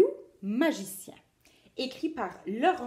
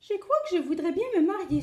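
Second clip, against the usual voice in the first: sighing rabbit; 9 semitones higher, 6 dB louder, faster than usual. Acted. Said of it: "soupire-t-il".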